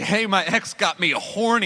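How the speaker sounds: lightly performed, high and drawn-out; mock girlfriend announcement.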